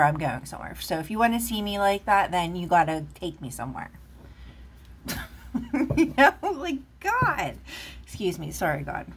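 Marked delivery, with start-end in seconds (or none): 4.9-5.9 airy laugh. Real, not acted. Laughs at own joke. 6.0-7.6 high laughing exclamation, much higher and louder; real giggling aside. Real, not acted. Laughing after joke.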